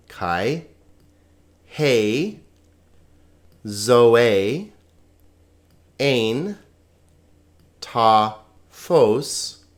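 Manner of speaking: slow single words with long pauses between; separated, deliberate pronunciation. Shown as a demonstration. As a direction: model each Greek word for learners. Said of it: "on to the pronunciation".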